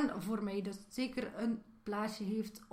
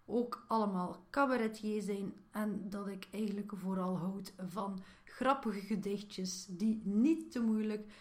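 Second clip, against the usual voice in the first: ordinary talk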